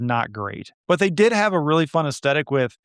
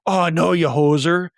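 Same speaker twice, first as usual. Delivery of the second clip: louder, higher hammed-up Canadian; bad Canadian accent; 5 semitones higher, 4 dB louder than usual. Shown as a demonstration. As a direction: play a cartoonish Canadian, ending on a questioning "eh". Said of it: "my bad Canadian accent there".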